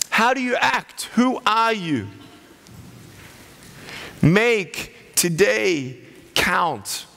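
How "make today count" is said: ordinary talk, nothing marked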